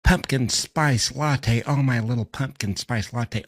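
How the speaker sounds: high cooing pet-name gushing; syrupy baby-talk endearments, hammed up; doting dad, mocked. Mocking the father's doting sweet talk.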